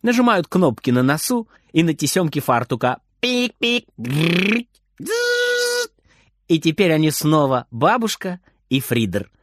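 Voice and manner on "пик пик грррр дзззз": robot beeps and buzz. A narrator's reading. Robots switching off.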